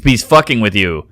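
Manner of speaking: ordinary delivery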